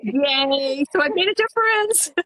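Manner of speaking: high, giddy celebration; pleased and playful, voice lifted; delighted cheer. Real, not acted. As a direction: cheer happily with your pitch up high. Celebrates the good client news.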